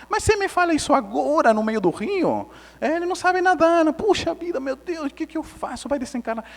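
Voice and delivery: high-pitched comic dismay; helpless retold fretting, pitched high; retold panic. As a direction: rattle through mock panic in a high voice. Retold panic, nephew cannot swim.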